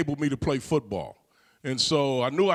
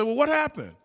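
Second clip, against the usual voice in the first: urgent question; 10 semitones higher than usual. Retold or imitated. Pressing for details of murder.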